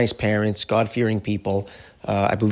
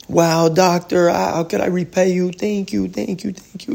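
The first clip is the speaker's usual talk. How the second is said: overwhelmed grateful mother; 9 semitones higher than usual. Retold or imitated. Mother thanks doctor, overwhelmed.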